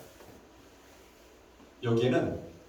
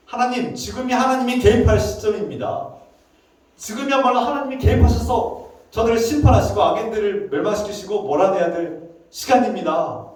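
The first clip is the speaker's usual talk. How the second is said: raised, forceful appeal, easing toward the end; urgent remonstrance; 6 semitones higher, 4 dB louder than usual. Acted. Prophet urges God to intervene and judge now, forceful protest. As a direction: press God to act, high and insistent. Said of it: "하고 지금 항변하고 있는 거죠".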